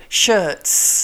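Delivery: "shirts" with a long clear S at the end; final S sounded. Shown as a demonstration. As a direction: hold the closing hiss of "shirts". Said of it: "missing the S off of the end".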